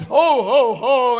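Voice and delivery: loud, booming ho-ho-ho; hearty Santa call; Santa's belly laugh. Retold or imitated. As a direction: play Santa greeting a crowd. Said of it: "Santa says".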